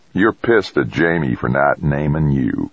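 ordinary delivery

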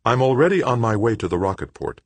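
firm. A narrator's reading. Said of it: "Joanna said firmly".

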